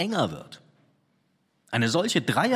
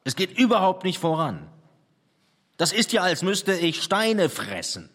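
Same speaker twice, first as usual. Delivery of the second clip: higher, louder, exasperated lament; despairing complaint; 7 semitones higher, 4 dB louder than usual. Acted. Painter complaining to his sitter, higher and louder in despair. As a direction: vent the frustration upward.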